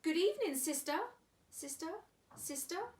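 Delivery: high quacky duck call; quacking duck. Acted. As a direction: quack it up to the cave. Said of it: "She quacked".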